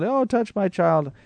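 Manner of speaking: high, slow pleading parent; high pleading plea for the child; clamoring parent. Retold or imitated. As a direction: beg like an eager parent pushing forward. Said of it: "some clamoring mother or father".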